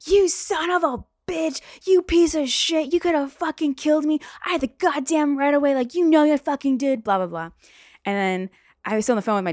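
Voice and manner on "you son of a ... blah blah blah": high, loud furious tirade; cursing someone out. Retold or imitated. Pedestrian at cab window after near miss, furious cursing. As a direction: shriek the insults at full force. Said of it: "cursing him out".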